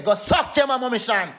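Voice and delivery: normal talking voice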